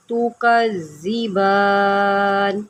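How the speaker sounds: slow, drawn-out tajweed chanting; measured melodic Quranic recitation. Shown as a demonstration. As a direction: recite slowly, stretching each vowel. Recitation of verse.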